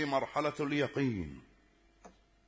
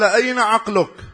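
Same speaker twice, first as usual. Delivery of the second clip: loud and higher, pressing; loud pointed question; 9 semitones higher, 11 dB louder than usual. Retold or imitated. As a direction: throw the question at him. Child presses the atheist teacher. (سؤال حاد بصوت عالٍ)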